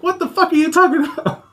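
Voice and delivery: high-pitched baffled protest; squeaky mock bewilderment; retold childhood disbelief. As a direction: imitate an incredulous little kid. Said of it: "was a little kid".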